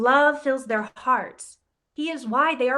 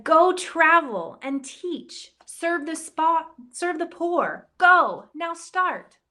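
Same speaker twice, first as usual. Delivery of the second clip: higher, slow, urgent call; commanding inner voice; 6 semitones higher, 3 dB louder, slower than usual. Acted. His heart tells Ignatius to go, urgent command. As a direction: send him out with a call.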